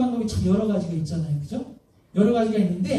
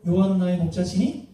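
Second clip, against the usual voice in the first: usual speaking voice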